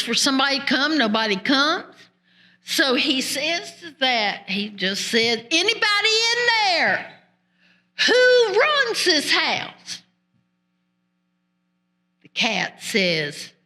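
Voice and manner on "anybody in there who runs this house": calling into the house. Acted. Jack calls into the house.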